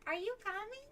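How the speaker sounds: high meowy voice with laughter in it; be the cat nagging at the door; talking cat imitation. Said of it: "It sounded just like that".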